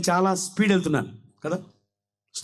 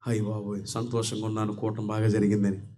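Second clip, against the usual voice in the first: mock dismay; 13 semitones lower, 7 dB quieter than usual. Preacher mocks his own dismay.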